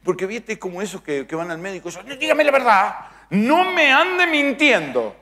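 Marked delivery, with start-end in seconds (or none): 2.1-5.2 high, loud insistence, drawn out; mock indignant demand. Patients demanding the truth from their doctor, mock indignant. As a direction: demand it in an exaggerated high shout.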